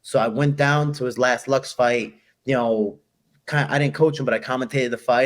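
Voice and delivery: ordinary talk, nothing marked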